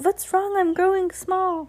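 high-pitched imitation of a panicked boy; mock cry of alarm. A narrator's reading. Very tall boy finding himself shrinking, high mock cry of alarm. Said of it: "cries out".

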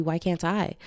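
normal talking voice

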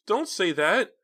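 very high loud plea; anguished cry. A narrator's reading. Philip sinking to his knees, anguished cry.

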